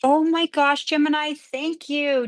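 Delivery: high, loud, slowed gush of thanks; thrilled thanks. Real, not acted. Nail artist thanking her sponsor, excited and loud. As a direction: burst out in real delight. Reading a sponsor's message live.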